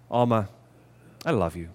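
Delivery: soft, low, tender declaration; hushed, heartfelt affection. Shown as a demonstration. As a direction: say it gently and lovingly, voice dropped. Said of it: "the way that we say things".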